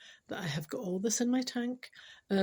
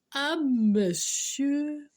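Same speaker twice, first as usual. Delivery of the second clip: slow reading; 5 semitones higher, 4 dB louder, much slower than usual. Acted. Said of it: "The text said".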